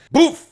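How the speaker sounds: sudden loud, high vocal bang; short, booming gunshot noise; imitated gunshot. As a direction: fire off an explosive mouth-made shot.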